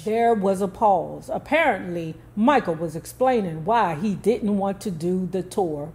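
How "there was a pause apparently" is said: ordinary delivery, nothing marked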